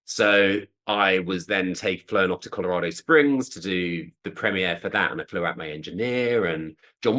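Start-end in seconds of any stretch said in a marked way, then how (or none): none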